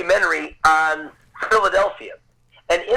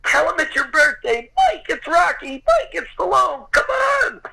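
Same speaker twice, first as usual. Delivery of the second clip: high excited mock yell, pitched way up; retold screaming; 12 semitones higher than usual. Family urging son toward Stallone, high mock yelling. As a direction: shout it like the family urging him on. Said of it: "pushing me and screaming".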